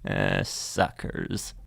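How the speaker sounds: muttered aside. Acted. Aside after customers leave.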